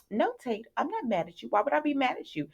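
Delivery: high, soft mock-innocent denial; passive-aggressive. A teen girl denying to a boy that she's mad, passive-aggressive. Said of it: "being passive aggressive to Tate".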